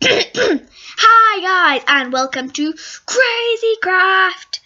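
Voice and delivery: high, loud gamer intro, title drawn out; iBallistic Squid. Retold or imitated. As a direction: announce the show in a high, stretched call. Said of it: "this is iBallistic Squid".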